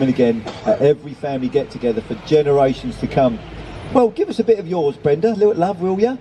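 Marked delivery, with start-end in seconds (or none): 3.9-6.2 put-on high-pitched old lady, still tickled; amused cajoling. A narrator's reading. Old lady asking Brenda for fish, amused cajoling. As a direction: coax in a high voice, as if just done laughing. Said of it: "still laughing".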